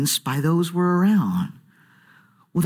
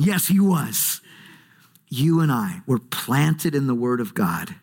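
normal talking voice

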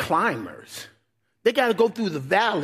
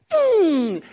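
imitated boom; 9 semitones higher, 5 dB louder than usual. Acting out shepherd's blow.

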